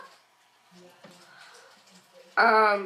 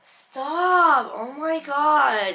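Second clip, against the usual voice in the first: annoyed; 4 semitones higher, 5 dB louder, much slower than usual. Real, not acted. Annoyed at interrupting sister.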